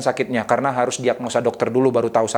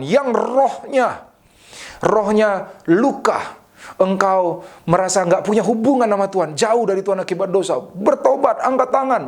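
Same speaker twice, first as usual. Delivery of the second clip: altar call; 5 semitones higher than usual. Retold or imitated. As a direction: re-enact an altar call, pitched up and stretched. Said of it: "Altar call pertama, saya katakan".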